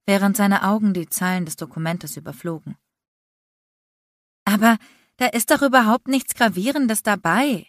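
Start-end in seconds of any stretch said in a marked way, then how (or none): 4.5-7.6 higher, whiny protest; complaining. A narrator's reading. Aubrey to Landon, dismissing the list, complaining. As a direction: brush the problem off with a complaint. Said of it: "beschwerte sich Aubrey".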